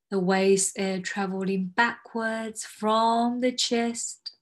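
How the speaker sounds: slow, soothing meditation guide; guided meditation. Acted. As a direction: ease them into calm breathing. Leads a grounding exercise.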